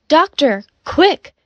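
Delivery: high-pitched call for help; urgent cry. A narrator's reading. Nurse calling the doctor to the wounded, urgent cry. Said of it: "the nurse cried".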